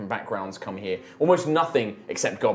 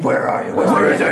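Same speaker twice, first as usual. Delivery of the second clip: loud, rough-whispered vigilante growl; mock dark-hero interrogation, forced and unvoiced; Batman voice; 8 dB louder than usual. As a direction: imitate a masked avenger demanding answers. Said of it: "the slight Batman voice".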